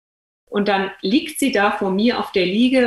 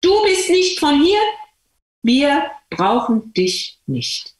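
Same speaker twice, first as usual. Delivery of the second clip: put-on higher, childlike taunt, then slowing; mock the children's rejection, higher; street kids taunting; 5 semitones higher, slower than usual. Children on the street rejecting the new girl, higher taunt. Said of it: "haben die Kinder auf der Straße gesagt".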